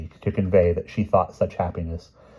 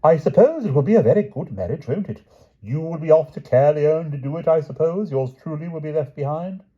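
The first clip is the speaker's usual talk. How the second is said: wistful Palomides; 10 semitones higher, 6 dB louder than usual. Acted. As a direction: sigh it out longingly. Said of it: "Sir Palomides said wistfully".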